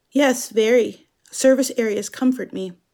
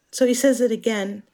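ordinary talk

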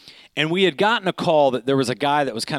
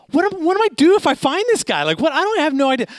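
very high, frantic self-questioning; hammed-up panic of someone out of their depth; mock panic; 13 semitones higher, 4 dB louder, faster than usual. Man running through woods after a suspect, mock panic.

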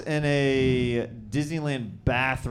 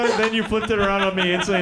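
usual speaking voice

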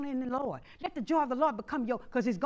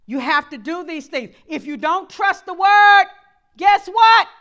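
louder, rising preacher's push; preaching at full force; 6 semitones higher, 16 dB louder, slower than usual. Acted. Pastor warning the church, loud. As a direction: hammer the point home.

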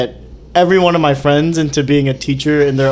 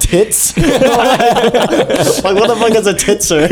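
higher, baffled question through laughter; incredulous, laughing as you ask; laughing disbelief; 8 semitones higher than usual. Retold or imitated. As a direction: retell the bafflement while cracking up. Retelling baffled reaction to misspelling.

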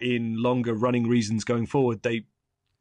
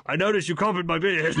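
higher, dry Thor voice; Thor impression; 6 semitones higher than usual. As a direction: deliver it deadpan as Thor. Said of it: "Cap and Thor ... goes".

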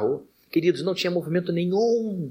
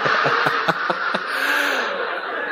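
high laughing burst; 9 semitones higher than usual. Real, not acted. Laughing at own story.

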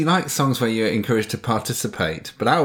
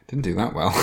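ordinary talk

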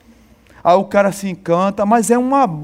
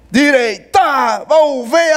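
drill command; 3 semitones higher, much slower than usual. Retold or imitated. Recruit reflexively doing drill command.

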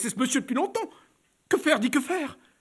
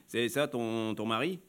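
low man; 9 semitones lower, 7 dB quieter, slower than usual. Acted. Client's voice.

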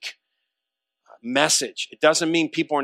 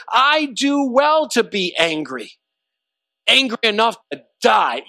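emphatic anger; 6 semitones higher, 4 dB louder than usual. Acted. Jonah answers God about the dead plant, emphatic and angry. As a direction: push each word out hard, pitched up in defiance. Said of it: "he's emphatic, and he says".

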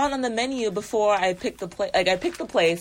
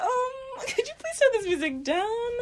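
sweet polite request; 3 dB quieter than usual. Retold or imitated. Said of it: "I was like".

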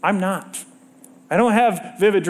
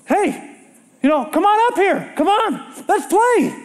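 God's voice; 8 semitones higher than usual. Acted. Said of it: "And now you got God saying".

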